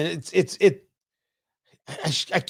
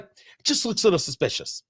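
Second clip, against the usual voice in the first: mocking Candace Owens; 5 dB quieter than usual. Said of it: "saying things like".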